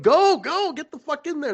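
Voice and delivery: very high-pitched retold yell; frantic urging. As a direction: push them in, starting loud and squealing high. Retold urging, more insistent.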